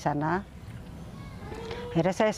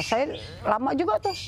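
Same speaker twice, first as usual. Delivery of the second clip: ordinary talk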